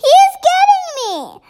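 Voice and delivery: high, fast panicked cries; screaming in terror. A narrator's reading. Giant dreams he is being hunted, screaming. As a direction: shriek it as if being chased. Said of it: "screamed the flesh lump eater".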